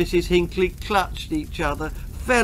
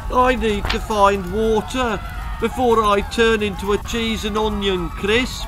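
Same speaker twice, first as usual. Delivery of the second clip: desperate; 5 semitones higher, 3 dB louder than usual. Acted. Desperate plea.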